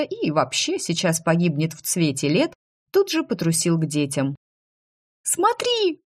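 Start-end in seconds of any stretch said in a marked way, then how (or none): 5.2-6.0 very high excited squeak; high-pitched childish cry of delight; little girl's squeal. A narrator's reading. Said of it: "пискнула девочка".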